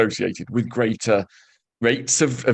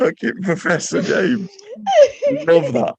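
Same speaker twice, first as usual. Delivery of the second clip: laughing. Real, not acted. Laughing at the joke.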